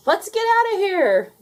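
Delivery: very high, loud yell. A narrator's reading. Duck yelling as the diner crowd rounds the corner, high. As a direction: yell to flee. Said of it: "yelled Duck".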